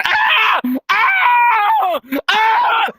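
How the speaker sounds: high, loud mock moaning shouts; imitated climax cries. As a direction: imitate someone crying out in climax. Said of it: "he just starts going".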